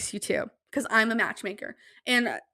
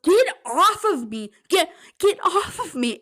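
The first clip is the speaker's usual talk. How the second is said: higher, louder, slow get-off cry; mock yell; 7 semitones higher, 4 dB louder than usual. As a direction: shake her off, half yelling. Girl jumped on her back.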